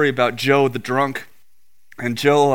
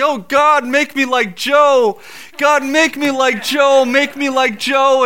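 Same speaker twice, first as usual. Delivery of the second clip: high, loud, drawn-out plea to God; crying out in prayer; 9 semitones higher, 5 dB louder, slower than usual. Retold or imitated. Sobbing man at the altar begs God, crying out. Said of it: "he's just crying out".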